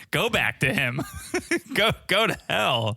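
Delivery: giggling while mimicking a yell; half-laughing mock anger; laughing through quote.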